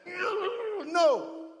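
high, shouted, mock-defiant no's from a clinging demon; stubborn demon refusing. Preacher acting out a demon resisting being cast out, high mock shouts. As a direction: act out a demon refusing to leave. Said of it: "He's like".

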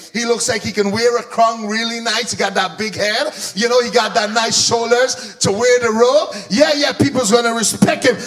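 lightly voiced impressed crowd; admiring onlookers. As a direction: size up the would-be king. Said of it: "they're gonna look at you".

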